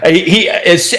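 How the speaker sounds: bursting out laughing. Real, not acted. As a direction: laugh out loud then talk. Laughs at player's line.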